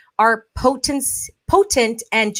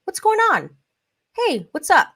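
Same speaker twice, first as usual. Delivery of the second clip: higher-pitched, casual check-in; lighter, open energy; 8 semitones higher than usual. Shown as a demonstration. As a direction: ask openly, with no judgment. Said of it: "See the difference in the energy".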